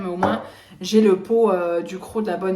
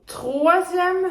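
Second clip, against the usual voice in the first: exasperated exclamation; 9 semitones higher, 3 dB louder than usual. Real, not acted. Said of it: "ça va faire trois vidéos".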